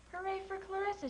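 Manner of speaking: high cheering shout; crowd cheer. A narrator's reading. Crowd at the fair cheers the cow, high and glad. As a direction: cheer her name like a whole crowd. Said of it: "shouted the crowd".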